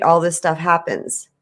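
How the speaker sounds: ordinary delivery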